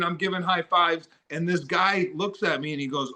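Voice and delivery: normal talking voice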